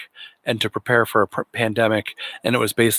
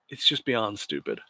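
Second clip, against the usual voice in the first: trailing, softer repeat under the breath; muttered aside; 9 dB quieter than usual. Real, not acted. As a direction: mutter "It's just beyond stupid" to yourself.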